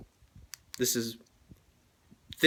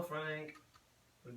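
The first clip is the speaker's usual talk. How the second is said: normal talking voice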